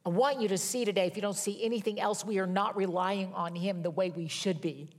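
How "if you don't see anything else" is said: ordinary talk, nothing marked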